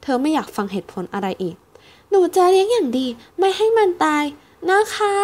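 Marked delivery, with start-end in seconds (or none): 1.8-5.1 put-on high earnest child promise; much higher childish plea; pleading little girl. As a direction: beg with your whole heart like a small girl. Child pleading to keep chick.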